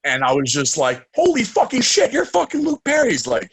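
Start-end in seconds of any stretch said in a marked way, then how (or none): 1.2-3.1 high, loud amazed outburst; starstruck. Retold or imitated. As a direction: blurt it out in disbelief. Starstruck reaction.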